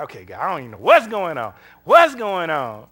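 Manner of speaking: pleading with God in confusion; anguished cry to God. Retold or imitated.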